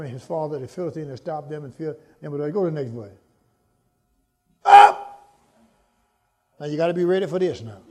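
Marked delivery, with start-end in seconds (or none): 4.6-5.0 sudden high loud cry; shouted cry. Real, not acted. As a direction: burst out with it. Shouted exclamation.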